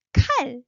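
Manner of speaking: 칼 with a burst of air, pitch rising; aspirated, rising 칼. Shown as a demonstration. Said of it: "rising, burst of air".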